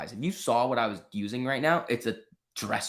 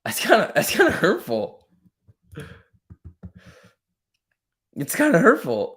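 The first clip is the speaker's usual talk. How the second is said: mock hurt; 8 semitones higher, 5 dB louder than usual. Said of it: "You calling, you saying I talk weird?".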